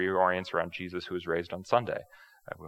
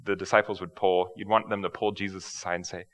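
ordinary talk

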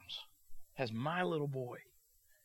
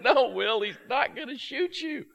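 laughing; 10 semitones higher, 8 dB louder than usual. Retold or imitated. Father laughing at son's fear.

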